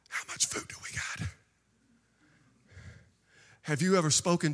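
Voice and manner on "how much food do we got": hushed worried whisper; whispered aside. Acted. Jesus aside, worried he promised.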